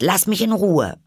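high, loud demand; indignant. A narrator's reading. Alice tells the pigeon to leave her alone, indignant. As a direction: push her away with a loud, high protest. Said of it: "sagte Alice entrüstet".

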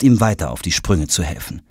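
ordinary delivery

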